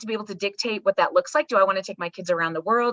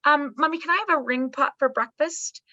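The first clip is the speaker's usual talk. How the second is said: put-on higher, small little-girl voice asking for a treat; four-year-old asking; 8 semitones higher than usual. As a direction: ask like a hopeful little kid. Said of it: "your four-year-old says".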